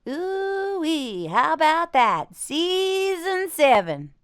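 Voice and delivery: high, slow catchphrase with a slight southern tinge; cartoon character impression. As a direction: stretch each phrase out in a high cartoon voice. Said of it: "Yours is getting a little bit southern".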